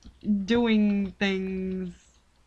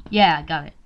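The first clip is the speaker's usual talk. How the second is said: ordinary delivery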